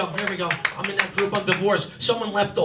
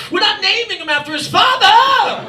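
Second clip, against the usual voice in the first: put-on high, loud English-accented shriek; imitating mother's outrage in an English accent; angry English accent; 12 semitones higher, 10 dB louder than usual. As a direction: yell it as a hammed-up English mother. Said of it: "she does it all in an English accent".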